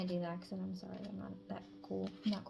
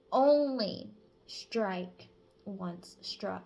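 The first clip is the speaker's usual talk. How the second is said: slow, much louder, each word hit; emphatic; 8 dB louder, much slower than usual. Real, not acted. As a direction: lay down the rule slowly and firmly.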